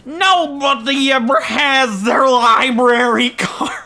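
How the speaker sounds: high, loud, very slow lament, breaking into laughter; exasperated little old-man voice. Retold or imitated. Librarian despairing at visitors without cards, high whiny little voice. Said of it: "puts his head in his hands".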